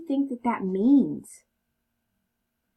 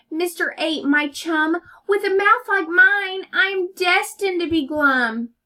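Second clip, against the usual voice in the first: loud whiny fish; 6 semitones higher, 5 dB louder than usual. Acted. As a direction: wail it like a doomed fish. Fish answers octopus.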